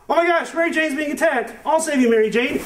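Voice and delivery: high mock-heroic Spider-Man. Spider-Man rushing to save Mary Jane, high and mock-heroic. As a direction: squeak it out like a corny hero. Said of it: "And he's like".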